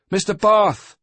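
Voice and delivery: loud, high call of a name; shouted. A narrator's reading. Breathless boy calling the sailing master, shouting. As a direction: yell it across the deck. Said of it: "he shouted".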